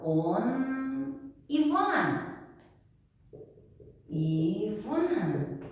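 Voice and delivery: drawn out slowly for learners; deliberate classroom pronunciation; slow teacher model. Shown as a demonstration. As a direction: say it slowly so students can repeat. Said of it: "model sentence for learners".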